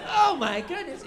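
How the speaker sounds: very high, loud imitation of a startled woman; mock shocked exclamation. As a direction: squeal it in exaggerated astonishment. Retold shocked reaction of the woman.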